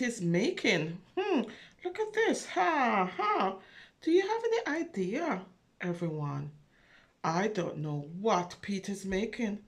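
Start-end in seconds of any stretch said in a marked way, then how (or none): none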